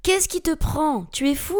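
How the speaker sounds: high-pitched, incredulous protest; alarmed cry. A narrator's reading. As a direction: cry out at an attacker. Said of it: "s'écria celui-ci".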